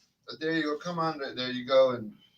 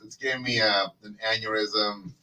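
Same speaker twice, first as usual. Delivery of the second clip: ordinary talk, nothing marked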